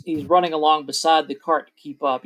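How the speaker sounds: ordinary talk, nothing marked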